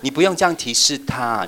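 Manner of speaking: usual speaking voice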